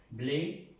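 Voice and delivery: "blé" with a clear Spanish-like E; teacher's demo of "blé", E fully sounded; French pronunciation. Shown as a demonstration. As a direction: model "blé" with an acute, closed E. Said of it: "y se pronuncia blé".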